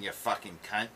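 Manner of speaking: put-on Australian accent; hammed-up mock Australian. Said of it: "do it in Australian".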